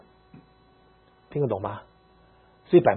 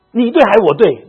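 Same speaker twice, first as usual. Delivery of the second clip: high, loud demanding challenge; fierce demand; 9 semitones higher, 11 dB louder than usual. Retold or imitated. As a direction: slam the question at her husband. Fierce wife confronts husband. (太太很凶地大声质问丈夫)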